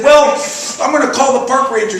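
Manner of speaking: high, loud, quick threat; indignant threat, retold. Storyteller threatening the golfer with the park ranger, acted indignation. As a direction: act out a heated warning, pitched right up.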